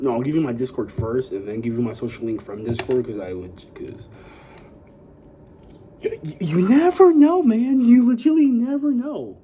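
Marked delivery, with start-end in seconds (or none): 6.0-9.4 much higher and louder, cartoon squeal; over-the-top high warning voice; squeaky comic voice. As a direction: shriek it in a silly falsetto.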